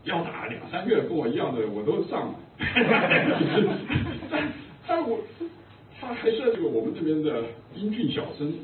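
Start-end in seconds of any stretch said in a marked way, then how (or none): 2.4-4.2 burst of laughter. Real, not acted. Laughing at own story.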